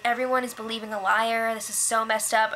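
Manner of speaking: ordinary talk, nothing marked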